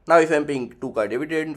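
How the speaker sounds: ordinary talk, nothing marked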